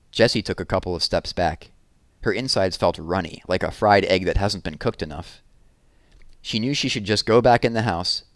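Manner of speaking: ordinary delivery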